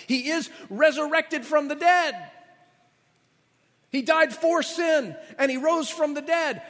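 high, loud, slow climax; ringing sermon climax; preaching at full force. Acted. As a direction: drive the resurrection home from the pulpit. Climax of Peter's message.

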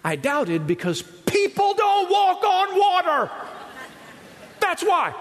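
hammed-up indignant Peter voice; Peter's exasperated answer. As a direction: play Peter, defensive and obvious. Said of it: "I know what Peter's answer is".

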